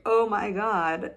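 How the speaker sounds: loud, slow, exaggerated awe; hammed-up blissful marvelling; mock amazement. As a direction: gush as if overwhelmed, in jest. Mimics overblown joy at healed finger.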